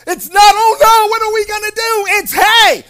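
loud, high, emphatic preacher's proclamation; full-force preaching. Real, not acted. Preacher telling the congregation God is doing something, full-force preaching. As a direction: drive each phrase home to the congregation.

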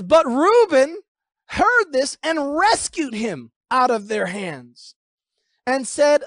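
emphatic scripture reading. Acted. Reuben pleads with his brothers, slowly and emphatically declaimed. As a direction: weigh each phrase of the plea. Reads Reuben's plea aloud.

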